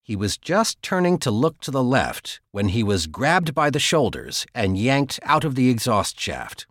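ordinary delivery, nothing marked

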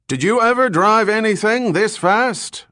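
high, loud shout; shouted question. A narrator's reading. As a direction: call it out over a roaring sea. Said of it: "Falconer shouted".

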